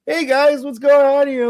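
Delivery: high, loud party greeting; put-on high voice calling to the room; Pokey. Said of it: "it's like Pokey walking into a party".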